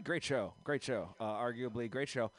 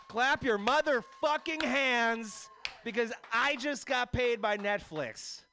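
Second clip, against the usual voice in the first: hype-man call; 10 semitones higher, 3 dB louder, slower than usual. Pretend. Hype-man shout at crowd.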